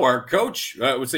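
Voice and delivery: usual speaking voice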